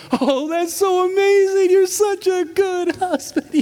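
put-on high, slow bubbly shop-girl voice; mock cheerful young cashier; perky girl. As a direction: play a gushing teenage clerk. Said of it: "And she goes".

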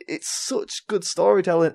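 normal talking voice

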